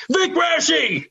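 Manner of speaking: retelling a hero's sudden shout; sudden yell. Retold or imitated. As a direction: shoot the name out like a eureka. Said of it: "He yelled".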